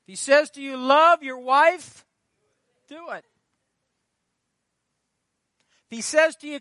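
loud, high, ringing sermon cry; preaching at full force. Acted. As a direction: proclaim it to the congregation. Preacher calls out God's commands.

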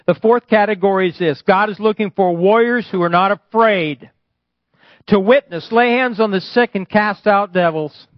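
high, loud preacher's proclamation; full-force preaching. Real, not acted. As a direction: proclaim it boldly to the whole congregation.

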